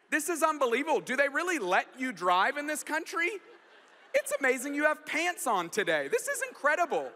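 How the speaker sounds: hammed-up exasperated telling-off; berating GPS lady. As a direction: scold the driver like an outraged satnav. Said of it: "What if she berated me".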